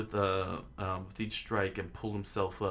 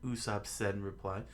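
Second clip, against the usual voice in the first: ordinary delivery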